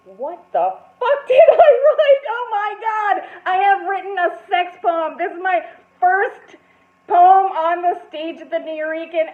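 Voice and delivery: panicked inner outburst. Retold or imitated. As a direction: realise in horror what you wrote. Panic at realising sex poem.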